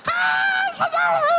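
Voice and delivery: very high, drawn-out mock shriek; hammed-up piercing screech like nails on a blackboard; imitated screech. Preacher imitating entranced prophetesses of childhood church, shrieking screech. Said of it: "gritar en un chirrido".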